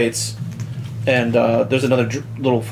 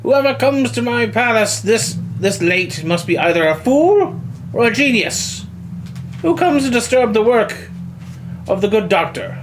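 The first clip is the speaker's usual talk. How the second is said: put-on raised, grand old scientist voice; pompous doctor; 7 semitones higher than usual. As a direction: announce yourself like a proud mad doctor.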